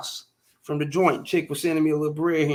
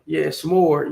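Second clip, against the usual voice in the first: ordinary talk, nothing marked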